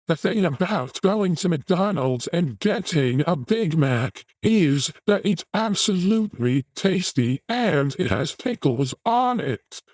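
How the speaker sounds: high, drawn-out mock British voice; Andrew Tate. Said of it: "Here's how Andrew ta-, uh, talks".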